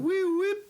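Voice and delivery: high, loud wee-whip of a police siren; siren imitation. Band in car mimicking siren as police pull them over. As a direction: mimic the cop siren going off.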